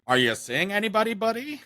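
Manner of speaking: hammed-up nosy relative; mock nosy relative. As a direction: tease like a prying aunt. Mocking relatives' nosy questions.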